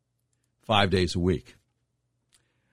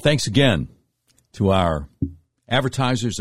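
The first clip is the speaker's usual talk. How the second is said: ordinary delivery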